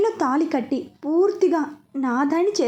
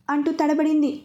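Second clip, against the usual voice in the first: ordinary delivery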